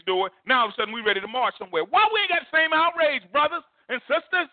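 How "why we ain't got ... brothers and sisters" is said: preaching outcry. Acted. Preaching climax to congregation.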